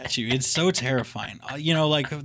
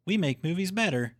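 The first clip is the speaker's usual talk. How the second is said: higher, American "better" with a clear final r; American accent; 5 semitones higher than usual. Retold or imitated. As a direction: imitate a plain American "better", r sounded. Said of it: "Now you're just doing an American accent".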